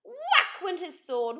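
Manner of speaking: plain reading voice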